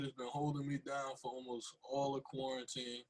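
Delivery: usual speaking voice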